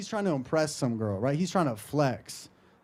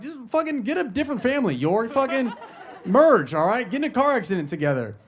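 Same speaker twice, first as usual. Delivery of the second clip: higher-pitched comic rant; mock exasperation; 6 semitones higher than usual. Comic ranting at English homophones, mock exasperated. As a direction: scold them in mock outrage.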